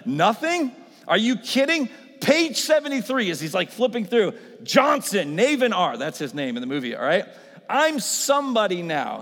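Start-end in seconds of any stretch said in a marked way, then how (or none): 0.1-6.0 high, loud, giddy disbelief; excited Navin. Retold or imitated. 7.7-9.2 loud, high, slow triumph; proud exclamation. Retold or imitated. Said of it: "He says".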